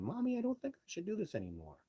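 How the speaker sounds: high, childish mock whimper; whiny child. Researcher mocking his own bad days, high childish whine. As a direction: whine it like a scared little kid. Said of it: "sucking my thumb going".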